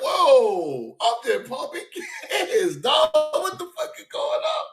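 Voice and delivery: high incredulous laughing protest; retold high-pitched disbelief; mock bewildered. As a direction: act shocked and confused. Retold confusion at the cops.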